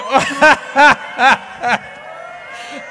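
big hearty laugh; loud laugh. Real, not acted. Preacher laughing at loyal football fans, loud laugh. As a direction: let a loud laugh burst out.

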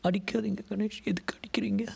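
high-pitched woman's protest; pleading woman. A narrator's reading. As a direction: plead with him to stop, pitched well up. Being hit, woman protests.

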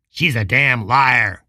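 angry rancher hissing an accusation; hissed. Acted. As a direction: hiss it with contempt. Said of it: "Custer hissed".

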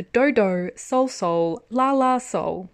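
slow, careful reading of the title; said carefully. Shown as a demonstration. Reading the drama title carefully for listeners, slow. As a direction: sound out Dodo Sol Sol La La Sol slowly. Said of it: "so I can say it properly".

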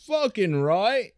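high, broad Aussie slang; exaggerated Australian banter; mock Australian accent. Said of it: "how those people talk".

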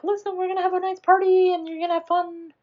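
very high, fast put-on voice; mock perky pep talk. Retelling her going upstairs about the party, mock perky pep talk. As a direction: imitate someone chirpily insisting on fun.

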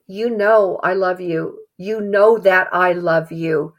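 slow, deliberate avowal; slow, understated heartfelt Peter; slow earnest confession. Retold or imitated. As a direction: linger on every word as if humbled. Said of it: "maybe him saying".

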